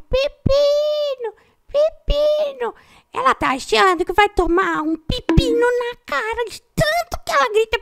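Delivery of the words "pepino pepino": high, stretched calls; calling out. Retold or imitated. Mother walking the neighbourhood calling for Pepino, high slow calls. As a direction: call his name slowly across the neighbourhood. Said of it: "ela anda pelo bairro".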